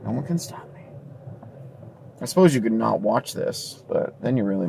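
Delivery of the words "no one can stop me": much softer, almost whispered; hushed echo. Acted. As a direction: murmur it like a secret. Repeated as quiet echo.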